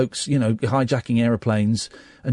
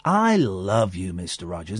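slow, soft and low; gentle slow child's voice; very slowly; 7 semitones lower, 4 dB quieter, slower than usual. Retold or imitated. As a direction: draw each word out gently. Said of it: "so it's very slowly".

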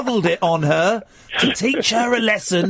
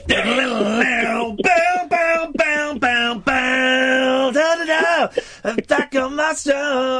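high wailing mouth guitar; mock guitar riff; 5 semitones higher, much slower than usual. Guitarist bursting back into his solo, imitated with the voice. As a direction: belt out rock riff noises, sustained and high.

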